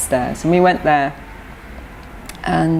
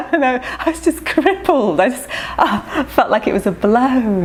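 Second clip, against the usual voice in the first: laughing through it; 7 semitones higher than usual. Real, not acted. Laughing while recalling the painful stare.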